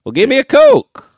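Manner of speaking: put-on Southern accent. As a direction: order like a Tennessee local. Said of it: "in the South, they'll call".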